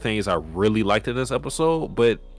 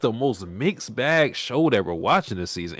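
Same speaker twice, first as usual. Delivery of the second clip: ordinary talk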